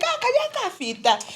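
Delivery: high-pitched mocking Zambian relative. Relatives teasing a dark child, high mocking squeal.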